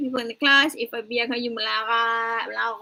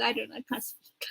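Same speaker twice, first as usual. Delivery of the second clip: whisper; 9 dB quieter than usual. Real, not acted. Gives up on Kelantan accent.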